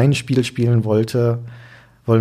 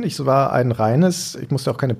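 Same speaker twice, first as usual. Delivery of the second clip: ordinary talk, nothing marked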